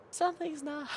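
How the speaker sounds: high, soft and strained; high mock wail. Storyteller repeating that something's not right, in a high mock wail. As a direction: pitch it way up, softly. Repeats line in a squeaky put-on voice.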